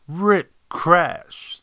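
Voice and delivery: startling loud sound effect in words; crash exclamation. A narrator's reading. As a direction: punch out a sudden crash. Narrator voices sudden crash noise.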